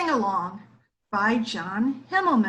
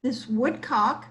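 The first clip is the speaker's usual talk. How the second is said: ordinary delivery, nothing marked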